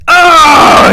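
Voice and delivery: long, high, loud groan of defeat; exasperated howl. Acted. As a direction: howl in frustration. Dad's despair at failed retest.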